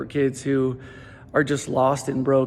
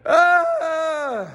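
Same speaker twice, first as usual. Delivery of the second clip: exasperated cry; 12 semitones higher than usual. Retold or imitated. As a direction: throw up your hands and cry out. Reliving the mess at 3am.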